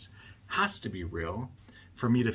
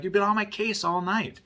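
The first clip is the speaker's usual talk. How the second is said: much higher, louder mock complaint; aggrieved accusation; 11 semitones higher, 6 dB louder than usual. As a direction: act out the fed-up husband, voice jumping up. Husband's accusation in retold quarrel.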